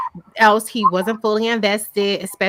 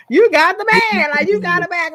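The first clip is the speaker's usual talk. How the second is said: delighted cheer; 8 semitones higher than usual. Retold or imitated. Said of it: "I'm like".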